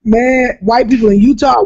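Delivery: normal talking voice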